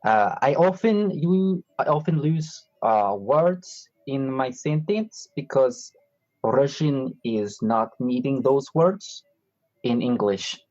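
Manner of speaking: halting put-on Russian accent; fake Russian accent. Host claiming this is normal speech, in a fake Russian accent. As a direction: play a Russian speaker searching for English words. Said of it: "I only do Russian accent".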